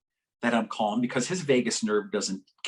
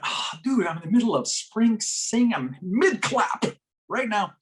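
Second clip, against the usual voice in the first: higher-pitched mock whine of protest; exasperated protest; 7 semitones higher than usual. Retelling a reply to a teacher mid-show, exasperated protest. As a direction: protest being pulled away, pitch jumping up. Retold protest at being interrupted.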